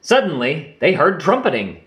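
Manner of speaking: ordinary delivery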